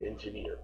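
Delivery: quiet "Engineer", accent on final -neer; stress on last syllable. Shown as a demonstration. Teacher confirming it's the last one, soft "Engineer" stressed on the end. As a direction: softly repeat "Engineer", weight on the last syllable. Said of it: "It's on the last one".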